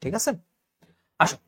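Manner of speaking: usual speaking voice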